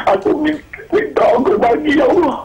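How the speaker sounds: high, broken voice, half laughing, half sobbing; overwhelmed, tearful thanks to God; crying prayer. Retold or imitated. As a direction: let the tears break the words as you pray. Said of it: "Saya menangis".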